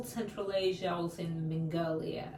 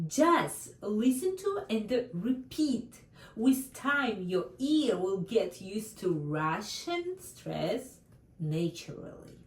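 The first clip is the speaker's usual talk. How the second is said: usual speaking voice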